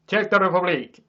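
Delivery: loud, high, hammed-up Czech accent; mock Czech accent. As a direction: shout it in a put-on Czech accent. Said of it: "I wanna do that, and I don't know why".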